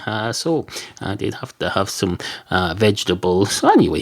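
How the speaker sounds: normal talking voice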